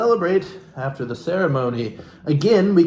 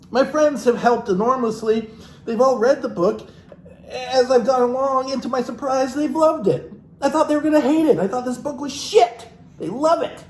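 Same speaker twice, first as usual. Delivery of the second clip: higher, sing-song parody reading; mock author voice; 11 semitones higher than usual. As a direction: read it as a gushing parody of her. Reads the book in a mock voice.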